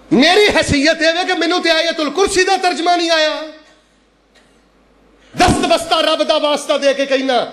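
high-pitched sermon warning; impassioned preaching. Real, not acted.

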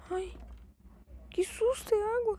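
high breathless voice after a scare; shaken, high-pitched relief. Real, not acted. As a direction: still shaken from the fright. (voz aguda de susto)

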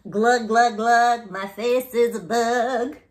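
loud, slow goofy gargle; silly gurgling voice. Acted. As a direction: gargle it out like a clown. Forced silly sounds from book.

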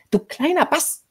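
very high-pitched shriek of shock; startled cry. A narrator's reading. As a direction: cry out as if just bitten. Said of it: "schrie sie vor Schreck". (vor Schreck aufgeschrien)

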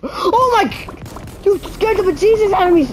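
high, fast frightened yelp; startled by a jump scare; scared shriek. Real, not acted. Jump scare in horror game.

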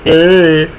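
ordinary talk, nothing marked

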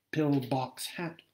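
plain reading voice

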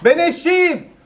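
shout. Pretend. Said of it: "önce ben eşeğim diye bağır".

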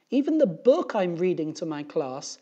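high, loud complaining teacher; aggrieved teacher. A narrator's reading. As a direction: pour out a put-upon teacher's grievance. Upset teacher complains to three men.

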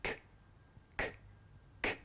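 short k sounds one by one; k sound repeated. Shown as a demonstration. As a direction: say the k sound several times, crisp each time. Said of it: "Can you have a try of making that sound?".

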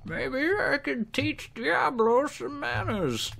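high, slow old-sage impression; Deckard Cain voice. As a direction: drawl it out slowly like the old sage. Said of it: "the Deckard Cain voice".

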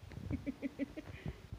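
high, quiet giggling; quick run of quiet giggles; soft giggle. Real, not acted. Owner amused by cat's tongue, soft giggle.

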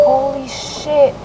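thinking it, not saying it aloud; a slow private gasp of panic; inner thought. Retold or imitated. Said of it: "this is what I'm thinking inside".

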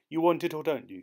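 a curt waiter losing patience; impatient waiter. Acted. Impatient waiter pressing customer.

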